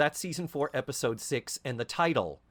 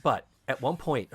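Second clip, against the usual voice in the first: normal talking voice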